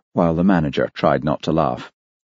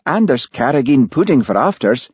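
raised, hopeful desperation; desperate; 7 semitones higher, 3 dB louder than usual. A narrator's reading. Charlie trying to keep Olivia at dinner, desperate. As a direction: offer it as a last hope. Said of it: "said Charlie desperately".

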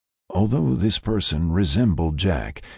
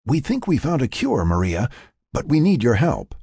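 higher-pitched explaining voice; Frank; 8 semitones higher than usual. Acted. Frank explains the revival, higher earnest voice. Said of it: "Frank explained".

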